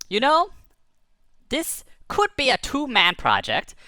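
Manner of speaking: excited. Acted. Bird pitches the space trip.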